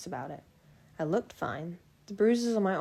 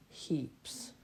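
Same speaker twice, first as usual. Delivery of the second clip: low, breathy teasing; smirking whisper; 3 semitones lower, 7 dB quieter than usual. A narrator's reading. Edward teasing Bella, low smirking whisper. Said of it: "smirked at me".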